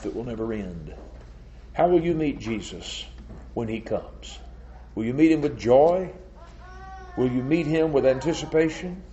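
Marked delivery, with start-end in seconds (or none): 1.7-9.0 raised, ringing rhetorical questions; urgent pulpit questioning; preaching appeal. Real, not acted. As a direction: press each question on the congregation, lifting the end. Preaches a challenge to congregation.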